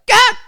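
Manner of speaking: short mock yelp of alarm; retold panicked yelp. Retelling finding a chick in the incubator, startled yelp. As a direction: re-enact a startled cry.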